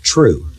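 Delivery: "true" set apart by pauses, low and weighty; stress on true. Real, not acted. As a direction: underline "true" by letting it stand alone, pitched low. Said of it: "underline the word".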